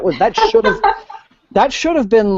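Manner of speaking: laughing high through the words. Real, not acted. Critic mocking the dull battle chapters, laughing. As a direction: let the laugh break into it.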